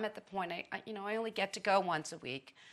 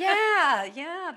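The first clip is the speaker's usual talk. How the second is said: laughing yeah; 11 semitones higher, 9 dB louder than usual. Real, not acted. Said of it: "that's convicting".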